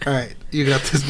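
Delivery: ordinary delivery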